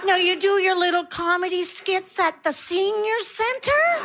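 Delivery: put-on high, slow, patronizing voice talking down to an old lady; condescending fan. Fan after the show, talking down to the comic, patronizing coo. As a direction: coo at her like she's a sweet old lady.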